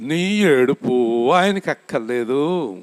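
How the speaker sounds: slow, stress on ఆయనకి; slow, weighty quote. Retold or imitated. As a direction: let each word of నీ ఏడుపు ఆయనకి అక్కర్లేదు land slowly. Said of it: "నాకన్నా బాగా చెప్తాడు ఆయన".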